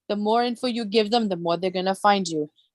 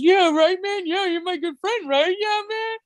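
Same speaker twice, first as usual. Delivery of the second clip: higher, sing-song mock of a fawning friend; mocking sucking-up voice; 8 semitones higher than usual. Mocking the man who backed down as a fake friend, high sing-song. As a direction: ham up a fake buddy's eager agreement.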